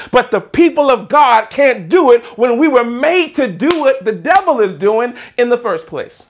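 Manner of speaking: loud, high, driving preacher's delivery; full-force preaching. Acted. Preacher declaring to the church that it all belongs to God, full-force preaching.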